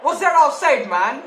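can't believe the call; outraged disbelief. Real, not acted. Disputed offside call.

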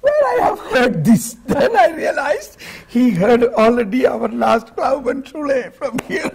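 high, laughing through the words. Real, not acted. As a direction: laugh while you tell it. Laughing at the funny story.

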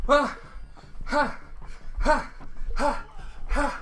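panting ha ha from exertion; winded panting mid-workout; out of breath. Real, not acted. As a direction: gasp out each ha while stepping. Panting through exercise game.

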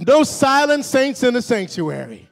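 rally the church to talk back; rousing preacher's projection; preaching. Real, not acted. Preaching at full force.

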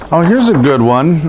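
ordinary talk, nothing marked

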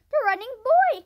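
high, loud exclamation; crying out. A narrator's reading. Man learning which boy it is, crying out. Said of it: "cried the man".

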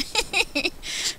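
breathy high-pitched giggle. Real, not acted. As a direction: giggle at her own joke. Laugh after taunting.

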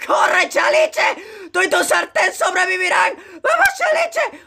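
high-pitched frantic cheering shouts; gritos de ánimo. Real, not acted. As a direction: shriek encouragement at your game character. Yelling encouragement at game character. (gritando ánimos con voz muy aguda)

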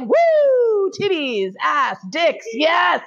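high-pitched mock cheer, word by word; gleeful whooping. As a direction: whoop it up like an overjoyed partner. Imitating partner's gleeful whooping.

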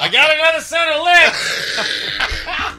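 silly fish voice. Pretend. Fish talking after its lips ripped.

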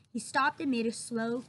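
usual speaking voice

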